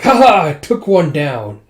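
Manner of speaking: slow loud gloating laugh. Retold or imitated. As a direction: gloat over the hit. Gloating soldier.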